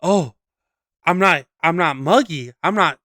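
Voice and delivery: a hint of a prissy tourist showing off; mock smug shopper. Mocking tourists in American malls, lightly smug put-on voice. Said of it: "they're like".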